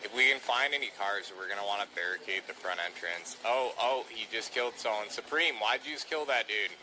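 ordinary talk

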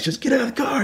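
high, aggressive shouted command; angry yelled demand. Retold or imitated. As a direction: bark it at the driver at gunpoint. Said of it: "He's yelling".

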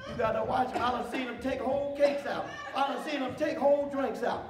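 loud, hyped-up sermon voice; fiery preaching. Acted. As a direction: preach it hard to the congregation. Preaching at full force.

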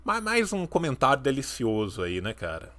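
normal talking voice

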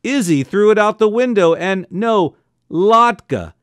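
loud exasperated phone yell. A narrator's reading. Father yelling into the phone at the colonel. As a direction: shout down a bad line. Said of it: "yelled into the phone".

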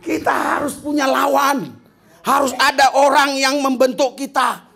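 chanted sermon climax; full-force preaching. Acted. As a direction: preach it out with rising fervour.

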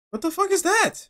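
sudden high startled outburst; high-pitched what-the-hell shock. Real, not acted. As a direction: jump at what you see. Startled by something on screen.

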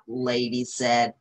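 ordinary delivery

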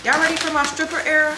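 fast, high hype; hyped. Real, not acted. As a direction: hype the viewers up. Hyped unboxing.

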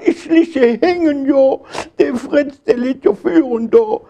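put-on high wail of the wrongly beaten boy; pleading protest. Karl protests while being thrashed.

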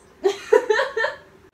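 high burst of laughter. Real, not acted.

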